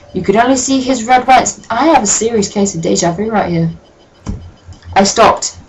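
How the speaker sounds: usual speaking voice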